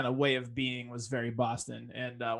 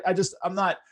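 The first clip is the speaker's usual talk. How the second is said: ordinary talk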